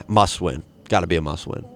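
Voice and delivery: ordinary delivery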